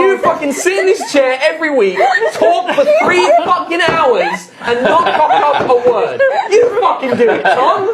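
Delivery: loud, high, building sweary rant; mock furious tirade. Host daring Tom to talk three hours without slips, mock furious. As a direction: rail at him at full volume.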